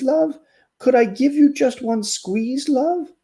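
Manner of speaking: a weary turtle pleading tenderly; storybook turtle wooing a bagpipe; lovesick turtle. Acted.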